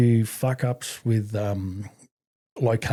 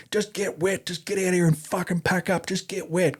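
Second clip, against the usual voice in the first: high, chanted nagging orders; insistent repeated urging; 7 semitones higher than usual. Retold or imitated. Ex-infantry mate ordering him out into rain, insistent. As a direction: keep pressing the order in a raised pitch. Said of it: "he just kept on saying to me".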